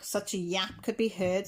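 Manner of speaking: usual speaking voice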